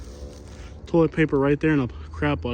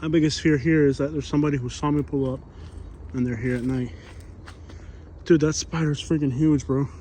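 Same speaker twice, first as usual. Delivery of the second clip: ordinary delivery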